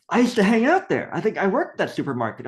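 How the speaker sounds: high, loud, thrilled mimicry; bursting out with a shared memory; excited discovery. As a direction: imitate someone delighted by a coincidence. Other man discovers shared past.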